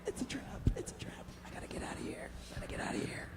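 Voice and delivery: frightened whisper. Acted. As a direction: whisper while hiding. Victim hiding from killer.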